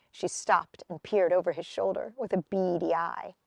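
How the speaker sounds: ordinary delivery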